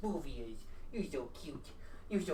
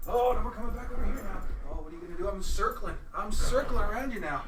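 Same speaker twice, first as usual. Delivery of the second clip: louder and higher, winding up the dog; excited playing; 5 semitones higher, 8 dB louder than usual. Real, not acted. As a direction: rile the dog up as you circle it.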